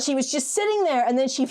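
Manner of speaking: ordinary delivery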